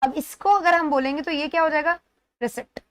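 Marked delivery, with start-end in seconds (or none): 2.4-2.8 रिसिप्ट with short i and a pronounced p; wrong-sound demo. Shown as a demonstration. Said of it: "ये गलत हो गया". (गलत उच्चारण: रिसिप्ट, प बोलकर)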